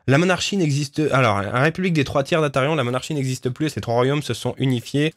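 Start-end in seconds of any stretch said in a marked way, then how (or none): none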